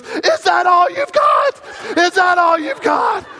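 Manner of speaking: high, loud, slow taunt at the waves; defiant shout. Retold or imitated. Little girl taunting the waves, shouting high. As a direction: yell a dare at the ocean like a little girl. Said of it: "and she goes".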